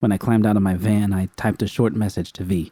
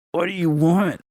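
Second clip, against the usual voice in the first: high, strained gasp of a question; sputtering in pain; 10 semitones higher than usual. A narrator's reading. Judy in pain asking the gang leader, sputtered. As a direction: force it out through pain. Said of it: "I sputtered".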